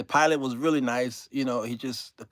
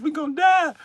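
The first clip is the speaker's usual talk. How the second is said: high, louder panicked cry; 12 semitones higher, 4 dB louder than usual. Retold or imitated. Passenger on the bumpy flight, high panicked cry. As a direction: yelp in mock panic.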